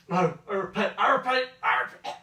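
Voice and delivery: high, loud, fading to breath; panicked gasping pleas. Acted. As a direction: beg while clutching your chest. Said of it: "clutches their chest going".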